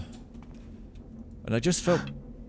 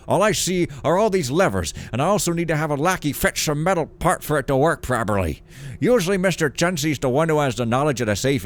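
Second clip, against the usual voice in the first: put-on louder, higher fussy old man voice; old man reading letter; 4 semitones higher, 4 dB louder than usual. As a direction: read it as a flustered old merchant.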